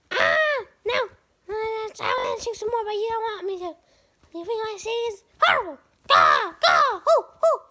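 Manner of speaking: high, excited bird voice; bird puppet voice. Pretend. The bird puppet showing off, high and excited. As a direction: squawk it up high like a cocky bird. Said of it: "the energetic type".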